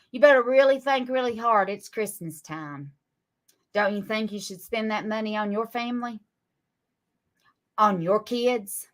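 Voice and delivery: ordinary talk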